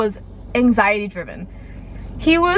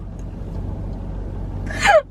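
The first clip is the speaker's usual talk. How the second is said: a high, breathless burst of laughter; breathy laugh. Real, not acted. Telling viewers the scary moment, nervous breathy laugh.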